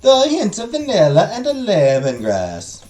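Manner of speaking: put-on Italian accent. Merchant offering herbal tea in slow Italian accent. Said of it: "my Italian accent".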